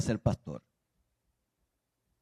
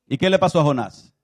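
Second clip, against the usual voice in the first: ordinary delivery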